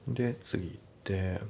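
ordinary talk

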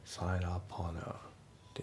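"サインアップオーナー" softly run together as sai-na-po-na; linked phrase. Shown as a demonstration. Teacher repeating sign up on our for learners, linked. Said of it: "リンキングでオーナーですね".

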